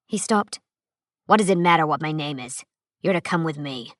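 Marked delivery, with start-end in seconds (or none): 1.3-3.9 lower, rougher male stranger's voice; a hint of a hard-bitten veteran; gruff stranger. Narrator voices Nale.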